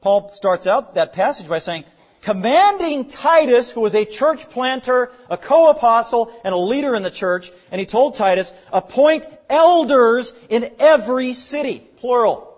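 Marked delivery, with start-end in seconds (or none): 2.2-12.5 high, loud preaching voice, building; emphatic preaching. Real, not acted. Preacher urging a plurality of elders on the church, forceful. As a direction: build in force as you press the scripture home. Preacher drives home scripture.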